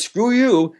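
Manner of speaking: high, loud screw you; talking back to the inner critic, high and defiant; defiant retort. Retold or imitated. Self-loving part talks back.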